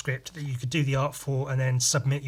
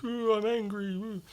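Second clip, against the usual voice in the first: put-on kid voice; 8 semitones higher, 4 dB quieter than usual. Kid voiced in comic retelling.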